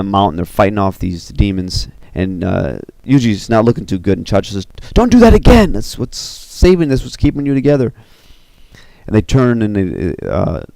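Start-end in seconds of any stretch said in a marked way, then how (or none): 4.9-7.9 high, loud outburst easing to a raised plea; urgent scolding. Acted. Chacha warns a weakened Yuji, urgent scolding. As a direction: burst out high and loud, then ease off.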